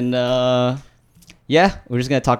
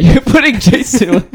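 high, slow laughing disbelief; 11 semitones higher, 9 dB louder, slower than usual. Real, not acted. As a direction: laugh out the name.

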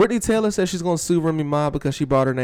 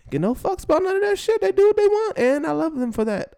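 excited high voice. Real, not acted. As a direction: voice shoots up high, thrilled. Excited praise of [name] and [name].

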